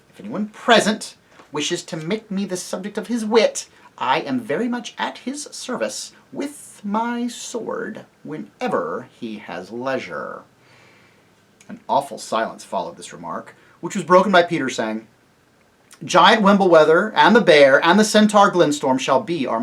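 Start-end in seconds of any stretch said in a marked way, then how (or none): 0.1-10.4 high, formal mouse voice, slowing at the end; challenging mouse. A narrator's reading. Mouse issues a challenge to mockers. 16.0-19.6 loud, higher, commanding announcement; ringing royal declaration; proclamation. A narrator's reading. Peter proclaims the marshals.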